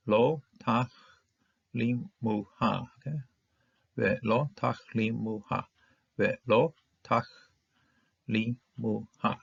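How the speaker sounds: Lo tach lim mu ha, each syllable set apart by pauses. Shown as a demonstration. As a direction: chop the word into separate beats. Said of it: "got lots of syllables here".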